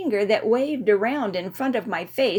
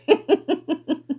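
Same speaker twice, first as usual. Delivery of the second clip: high tipsy giggle; 7 semitones higher, 4 dB louder than usual. A narrator's reading. Still giggling at burp.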